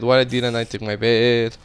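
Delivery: ordinary delivery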